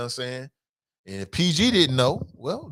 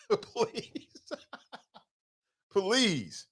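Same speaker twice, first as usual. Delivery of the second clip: please through laughter, high and soft; laughing please; 7 semitones higher, 7 dB quieter than usual. Retold or imitated. Sam begging Jeff, laughing mock plea. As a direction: giggle out the please. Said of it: "I bet Sam was like".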